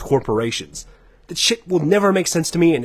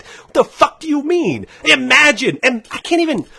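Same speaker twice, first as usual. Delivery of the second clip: high-pitched, worked-up angry rant; mad ranting; 7 semitones higher than usual. Real, not acted. Podcaster berating fans who fight battles for corporations, mad ranting. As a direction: rant in outraged disbelief, pitch soaring. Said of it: "this shit makes me so mad".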